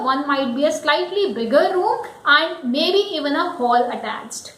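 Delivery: ordinary delivery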